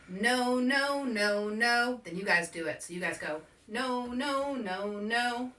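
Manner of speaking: usual speaking voice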